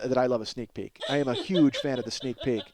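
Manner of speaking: ordinary talk, nothing marked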